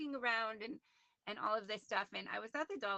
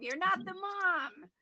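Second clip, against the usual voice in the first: high, drawn-out cat-parent telling-off; mock-stern and high, words stretched out; playful scolding; 9 semitones higher, 8 dB louder, much slower than usual. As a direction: chide a pet in a high, stretched singsong.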